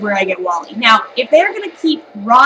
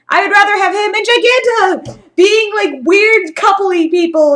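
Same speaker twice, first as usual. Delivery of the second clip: excited wish; 9 semitones higher, 5 dB louder than usual. Real, not acted.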